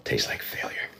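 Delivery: unvoiced deadpan whisper; whispered gloom; whispered aside. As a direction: breathe it out like a private joke. Whispered aside after punchline.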